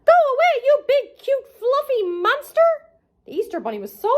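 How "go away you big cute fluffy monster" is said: much higher, panicked cartoon shout; scared yell. Patrick yelling at the Easter bunny, high scared yell. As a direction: shoo the monster away in a fright. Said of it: "screamed Patrick".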